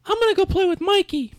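put-on very high little-kid voice; sulky small child. Refused child leaves to play with Mikey, sulky high kid voice.

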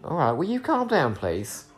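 high-pitched, playful telling-off; mock-exasperated pet talk; scolding a pet. Real, not acted. Chiding the pet during commentary.

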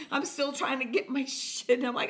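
ordinary delivery

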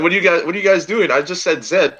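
high, hurried mock protest; acted bewilderment. Person who said Zed to the vomiting class, mock bewildered. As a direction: play baffled, pitched well up.

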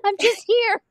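high, loud playful protest; mock protest. Mom in the ER refusing to work, mock protesting. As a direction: protest loudly that you're off duty. Acted protest at being recruited.

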